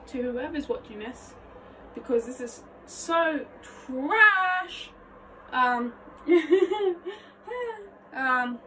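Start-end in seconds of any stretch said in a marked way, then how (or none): 2.8-4.9 "trash" hugely drawn out and loud; long swooping emphasis on "trash"; exaggerated self-mockery. Real, not acted. Mock-apologising for own performance. 6.0-7.2 high, loud giggle; bubbly high laughter. Real, not acted. Laughs at own mess.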